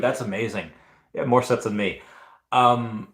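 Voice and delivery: ordinary talk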